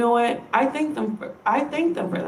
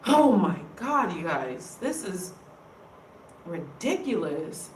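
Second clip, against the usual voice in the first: a little teary-eyed; 4 semitones lower, 6 dB quieter than usual. Real, not acted. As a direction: voice drops away, close to tears. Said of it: "I'm just getting a little teary-eyed".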